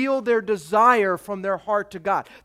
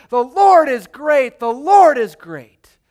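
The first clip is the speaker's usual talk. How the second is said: much louder, slow proclamation; proclaiming praise; 4 semitones higher, 11 dB louder, slower than usual. Acted. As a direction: declare it slowly and loudly.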